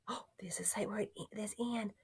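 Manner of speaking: very soft quick whisper; whisper aside. Real, not acted. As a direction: murmur it under your breath. Teacher whispers aside while decoding.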